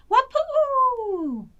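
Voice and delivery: high, loud happy wolf howl. Acted. Wolf's happy herding howl, high and loud. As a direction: howl it out with joy. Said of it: "howled his happy herding howl".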